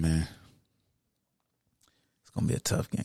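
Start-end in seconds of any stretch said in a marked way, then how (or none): 2.2-3.0 unvoiced, murmured to himself; breathy aside repeating the worry; under his breath. Real, not acted. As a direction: say it almost in a whisper, worried.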